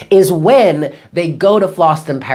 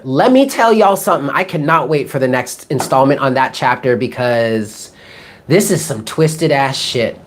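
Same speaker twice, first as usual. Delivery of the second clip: normal talking voice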